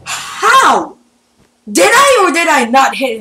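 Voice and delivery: high, loud disbelief; indignant outburst. Real, not acted. Gamer disputing a hit on opponent, indignant outburst. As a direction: demand an answer, loud and high.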